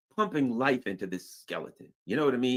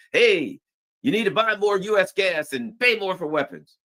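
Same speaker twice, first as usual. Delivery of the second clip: higher, louder demanding Trump voice; bossy Trump; 10 semitones higher, 5 dB louder than usual. Retold or imitated. Trump squeezing Europe, a higher loud demand. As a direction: lean on them like a pushy boss.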